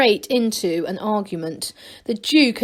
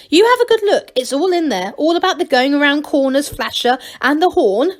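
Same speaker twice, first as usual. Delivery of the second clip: much higher and louder, gushing; enthusiastically; 6 semitones higher, 6 dB louder than usual. A narrator's reading. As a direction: gush about it. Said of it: "Angelo went on enthusiastically".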